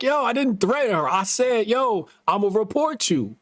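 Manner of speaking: high, defensive protest; indignant denial. Retold or imitated. Fired worker defending himself to manager, high indignant protest. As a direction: insist you never threatened her.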